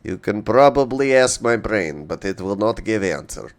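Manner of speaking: put-on lightly held Russian accent; broken-English Russian accent. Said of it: "As to why I'm doing a Russian accent".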